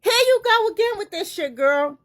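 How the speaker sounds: high, loud exasperated cry at the news; exasperated outburst. Real, not acted. Reacting to celebrity gossip, loud and exasperated. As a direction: throw up your hands, loud and high.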